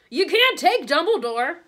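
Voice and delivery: slow, high, very loud yell; full-voiced, desperate protest. A narrator's reading. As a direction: yell it in outrage. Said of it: "yelled Hagrid".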